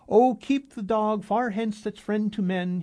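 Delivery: slow, loud, high theatrical declaiming. A narrator's reading. Arkady declaims verse at the dog-faced stranger, slow and loud. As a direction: deliver it like Shakespeare on a stage. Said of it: "he declaimed".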